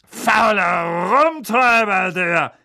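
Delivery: loud, high, slow bossy aunt; Aunt Marge voice. A narrator's reading. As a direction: boom it out like a tipsy aunt.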